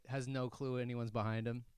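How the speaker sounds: usual speaking voice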